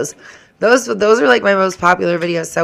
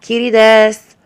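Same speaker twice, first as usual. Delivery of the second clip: high, loud put-on anime-girl greeting; playful fake cute voice; mock Japanese greeting; 6 semitones higher, 6 dB louder than usual. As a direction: chirp it like a cartoon character.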